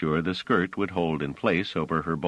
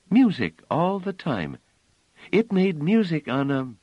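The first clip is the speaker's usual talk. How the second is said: put-on child voice; 12 semitones higher, 4 dB louder than usual. [name] asking Bliss, high childlike voice. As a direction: play the small innocent girl, up high.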